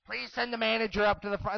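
store PA announcement. Retold or imitated. Cashier pages the manager as the embarrassed boy listens, loud PA call. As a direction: blare it out like a store page. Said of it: "that microphone".

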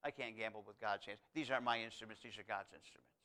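a low, hushed voice of a surgeon in awe; softly, as if holding something sacred; quiet humble surgeon. Retold or imitated. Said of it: "He says".

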